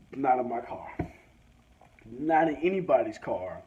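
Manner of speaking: ordinary talk, nothing marked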